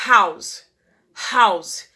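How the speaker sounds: "House" with a clear breathy h; teacher showing the aspirated H; aspirated h demo. Shown as a demonstration. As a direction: push air on the h of "House". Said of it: "dá pra ouvir esse H aspirado".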